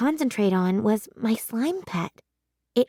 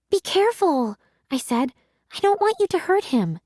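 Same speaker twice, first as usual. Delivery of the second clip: worried plea; 7 semitones higher than usual. A narrator's reading. Girl worried about her pet.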